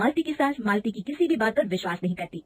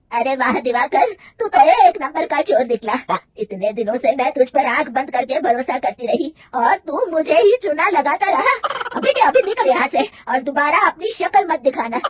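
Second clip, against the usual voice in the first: higher, louder furious rant; mother-in-law firing the cheating manager, loud and high; angry telling-off; 5 semitones higher, 8 dB louder than usual. A narrator's reading. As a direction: throw the thief out with contempt. Mother-in-law throws out the thief.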